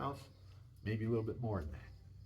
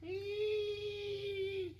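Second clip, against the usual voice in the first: very high imitated cat squeal; mock cat cry, very high and sudden. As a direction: squeal like a cat coming in with prey. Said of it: "They're going".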